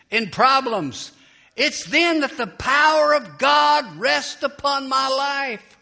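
loud, high, climactic sermon delivery; full-force preaching. Acted. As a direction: preach it to the rafters.

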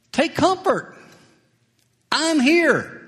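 high, gentle reassuring voice of Jesus; Jesus calming his frightened disciples, high and tender; voicing Jesus. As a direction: lift the voice up high and soothe them.

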